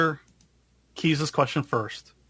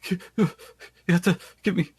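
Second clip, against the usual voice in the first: higher, halting plea in mock panic; panicked sobbing captive; 6 semitones higher, faster than usual. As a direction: beg for rescue, lightly acting a sob. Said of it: "s-, sobbing".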